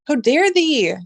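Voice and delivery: loud, high how dare they; mock outrage. Real, not acted. Streamer fuming at Disney over a cancelled show, mock outrage. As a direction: play up the indignation. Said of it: "I'm so mad".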